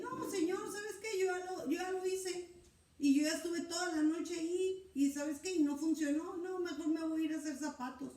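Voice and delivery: high, whining excuse to the Lord; a weary fisherman whining that he already fished all night; whiny complaint. Acted. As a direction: complain like a tired fisherman who already tried. Pedro voiced complaining to Lord.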